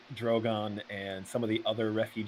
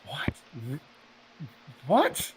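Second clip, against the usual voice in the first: confused surprise; 4 semitones higher, 5 dB louder, much slower than usual. Retold or imitated. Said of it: "Elaine's looking back and forth like".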